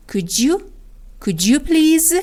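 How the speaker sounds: "Could you" blended into "coo-joo". Shown as a demonstration. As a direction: join the d and y of "Could you" into a j sound. («Could you» با صدای ج)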